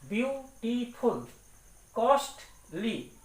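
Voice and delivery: ब्यूटीफुल and कॉस्टली said slowly, syllable by syllable; teacher chopping ब्यूटीफुल and कॉस्टली into syllables; split into syllables. Shown as a demonstration. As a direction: break each word into its parts, slowly. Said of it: "इसको हम लोग तोड़ के पढ़ते हैं".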